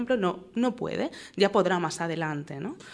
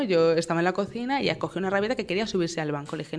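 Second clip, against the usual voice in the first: ordinary talk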